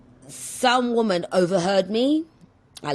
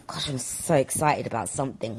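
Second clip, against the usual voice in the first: normal talking voice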